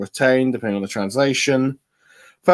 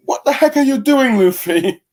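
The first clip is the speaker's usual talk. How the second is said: high, squeaky, louder disbelief; exasperated crewmate; 11 semitones higher, 4 dB louder than usual. Retold or imitated. Reviewer voicing a crewmate scolding Luffy, high squeaky yelp. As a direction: yelp it like a scandalised sidekick. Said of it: "someone's going like".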